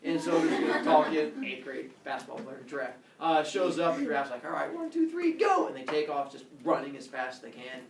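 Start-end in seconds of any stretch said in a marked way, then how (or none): 4.4-5.7 high-pitched countdown rising to a loud go; mock starter's call; acted race starter. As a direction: count them off and launch the race.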